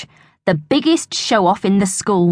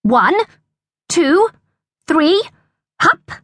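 rising command count; 9 semitones higher, slower than usual. A narrator's reading. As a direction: count up to a high hup. Dog trick command count.

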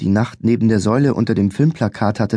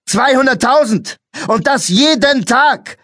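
angry bellow; 14 semitones higher, 4 dB louder than usual. A narrator's reading. Policeman bellowing numbers at the boy, enraged. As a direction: roar it at him. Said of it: "brüllte der Polizist verärgert".

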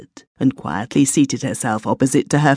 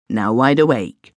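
plain reading voice

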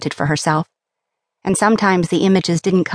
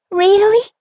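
put-on much higher, louder girlish squeal; excited squeal; 4 dB louder than usual. A narrator's reading. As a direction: squeal the question in delight. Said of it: "Hana squealed".

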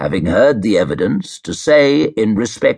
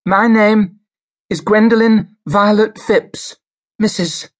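put-on much higher and louder, a frail old woman forcing her voice; speaking up loudly; 12 semitones higher, 3 dB louder than usual. A narrator's reading. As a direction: push the name out loud and high, determined to be heard. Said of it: "spoke up loudly".

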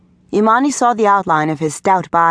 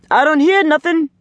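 high, carrying parental call; calling from upstairs; 10 semitones higher than usual. A narrator's reading. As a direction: call down to a child who stopped practicing. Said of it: "Maceo called out from upstairs".

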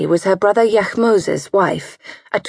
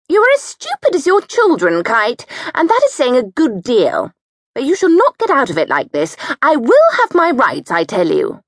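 much higher indignant demand for her rights; furious bullying scold; 7 semitones higher than usual. A narrator's reading. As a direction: spit it out in shrill outrage. Satipi furious at Kite.

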